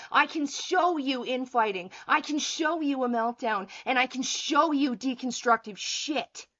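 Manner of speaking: loud, hammering fury at a commenter; furious rant. Real, not acted. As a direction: tear into the troll, full force.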